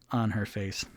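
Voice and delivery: ordinary talk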